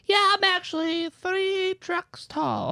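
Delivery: very high, slow goofy voice; squeaky cartoon liar; silly high voice.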